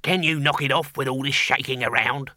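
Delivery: grumbling little demon's character voice; squeaky demon. Acted. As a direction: squeak out an irritated complaint. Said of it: "squeaked the demon".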